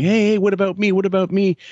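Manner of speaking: mock-pleading like a jealous little kid; whiny child. Son to his parents, whining child voice. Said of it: "he starts going".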